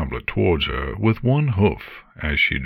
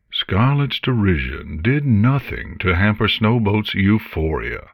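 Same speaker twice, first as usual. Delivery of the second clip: ordinary delivery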